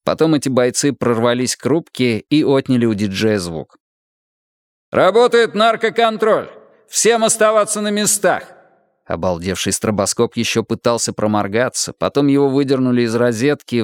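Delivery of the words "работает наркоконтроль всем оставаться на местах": police shout. Acted. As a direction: bark orders at the crowd like a raid cop. Police raid announcement shouted.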